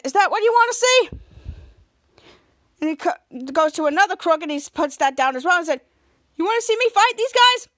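high, loud showing-off taunts; cocky taunting. Retold or imitated. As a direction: taunt the goons as you knock them down. Captain Man taunting while fighting.

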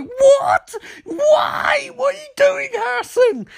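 shrieking in mock agony at the betrayal; hammed-up anguished screaming. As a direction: overplay the stabbed victim's cries. Stabbed villain crying out.

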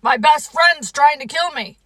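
alarmed cry. Acted. Toad being dressed by Frog, alarmed cry. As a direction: protest loudly as if under attack. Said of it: "cried Toad".

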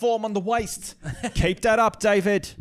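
high, loud sports commentator; soccer commentator. Pretend. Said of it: "the soccer commentator".

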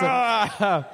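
high, put-on "ah" of torment; hammed-up yelp of discomfort; mock anguished cry. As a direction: cry out as if losing it. Plays skin itching with jealousy.

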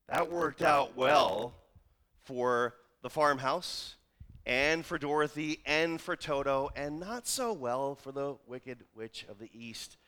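usual speaking voice

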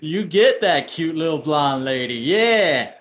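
loud, goofy hype-man egging on; loud, drawn-out approving yeahs; bro-style cheering. Acted. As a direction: cheer on a buddy's conquest. Acting out cheering him on.

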